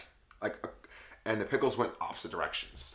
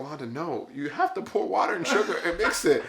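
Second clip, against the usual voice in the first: high-pitched retold protest; exasperated correction; 8 semitones higher, 4 dB louder than usual. Storyteller correcting a friend about the slush mix, exasperated. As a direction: set her straight, high and incredulous.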